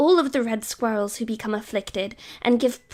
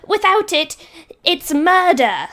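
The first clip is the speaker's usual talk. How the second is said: loud, high retort; shout back; 6 semitones higher, 8 dB louder than usual. Acted. Player shouts back at the council squirrel. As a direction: fire it straight back. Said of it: "I shout back".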